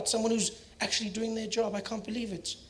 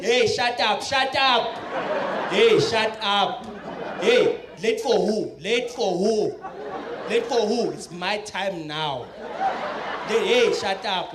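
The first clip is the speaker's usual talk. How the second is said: defiant Jacob; much slower than usual. Retold or imitated. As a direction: shout down parliament like a wild president. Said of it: "Jacob voiced in skit".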